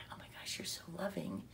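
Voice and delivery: hushed, quick marvelling; soft, voiceless gush of wonder; awed whisper. Retold or imitated. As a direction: whisper it in amazed admiration. Marvelling at the sweet woman.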